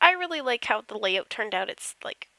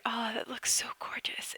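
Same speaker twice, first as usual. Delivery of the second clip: awed whisper; 8 dB quieter than usual. Real, not acted. Admiring the finished spa screenshot.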